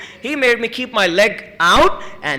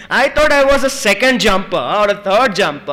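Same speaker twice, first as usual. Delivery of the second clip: ordinary talk, nothing marked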